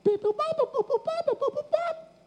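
very high-pitched dial-tone beeps; high electronic beeping by mouth; imitated phone dialing. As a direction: mimic an old phone dialing in squeaky tones. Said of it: "So I dialed her up on the phone".

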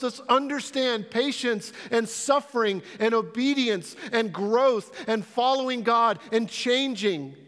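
loud, high, driving sermon delivery; rising pulpit intensity, pressing each point; full-force preaching. Acted. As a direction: preach it out with fire to the congregation.

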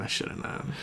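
breathy whispered aside. Shown as a demonstration. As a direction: whisper it to yourself. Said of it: "unvoiced repeat".

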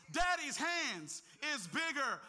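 loud, high, slow preacher's cry; preacher shouting the refrain to the church; preached refrain. Acted. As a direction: hammer the refrain home slowly.

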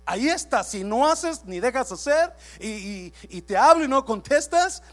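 loud, slow, emphatic preaching; preaching at full force. Acted. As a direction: hammer each line home to the church. Pastor preaching to congregation. (predicación a todo pulmón)

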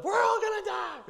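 hammed-up terrified fishermen yelling for help; panicked disciples. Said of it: "probably what it sounded like".

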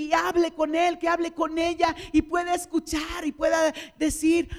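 loud, high, fervent preaching. Real, not acted. Preacher urging the church to pray bigger, fervent. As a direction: preach it out with rising force.

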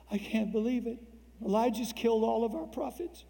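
high whiny voice to mommy; whiny Ahab. Retold or imitated. Weak king whining to his wife, high and whiny. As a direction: whine like a scared boy running to mom. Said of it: "he ran to mommy".

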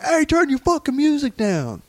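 high, slow mock neighbor yell. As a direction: imitate the angry neighbor. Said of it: "He's like".

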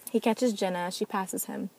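usual speaking voice

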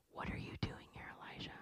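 hushed unvoiced question; God whispering to Elijah in the cave; gentle whisper. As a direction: ask softly, barely a breath. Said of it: "it's the gentle whisper".

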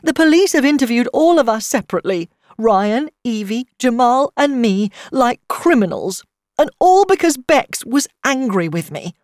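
high, loud, tearful outpouring; trembling with emotion. A narrator's reading. Mother telling how police questioned the family, trembling with emotion. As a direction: build from upset to a trembling wail. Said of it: "Her voice trembled with emotion".

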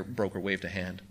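usual speaking voice

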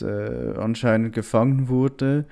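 ordinary delivery

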